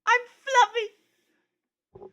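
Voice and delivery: very high, drawn-out delighted squeal; giddy squeal. Acted. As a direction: squeal it like a thrilled kid. Delighted by his feathers.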